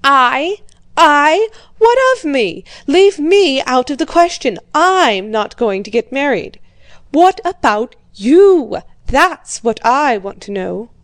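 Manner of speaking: put-on an old father shouting at his daughter; angry shouting. A narrator's reading. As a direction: explode in indignation, voice leaping up.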